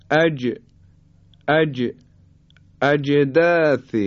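"اجداث" with ج released in a small bounce; qalqalah on ج. Shown as a demonstration. Teacher models the letter jim in "اجداث", bouncing qalqalah. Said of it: "حالا به حرف جیم توجه بفرمایید".

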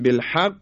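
"بالحق" with ق held, then firmly released; firm qalqalah. Shown as a demonstration. Teacher explains stronger degrees using "بالحق", firm qalqalah. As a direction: hold the doubled ق of "بالحق" before it pops. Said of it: "تلفظ کردن به صورت محکم".

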